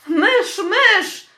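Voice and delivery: put-on children joining the teacher's screams; children shouting. A narrator's reading. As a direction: shout it out with the whole class. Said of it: "krzyczały dzieci".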